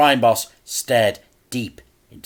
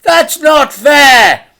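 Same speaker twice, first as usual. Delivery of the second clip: put-on much higher, loud slow boy shout; protesting shout; 16 dB louder, much slower than usual. A narrator's reading. Frank protests the unfair debt, shouting. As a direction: yell it in outrage. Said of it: "protested Frank".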